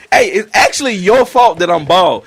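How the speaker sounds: ordinary talk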